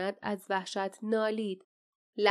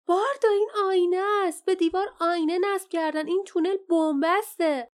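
high, loud, urgent cry; alarmed shout; 9 semitones higher, 6 dB louder than usual. A narrator's reading. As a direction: shout a frantic warning to a companion. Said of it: "فریاد زد". (فریاد هشدار)

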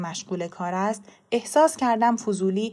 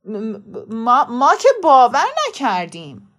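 halting stammer, then a louder blurt; flustered principal faltering into a denial; 2 semitones higher, 8 dB louder than usual. A narrator's reading. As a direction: stumble on the first syllable, caught out. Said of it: "مدیر منمن کنان گفت".

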